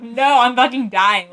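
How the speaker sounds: loud, slow laughing reply; cracking up while saying it. Retold or imitated. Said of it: "when I was like".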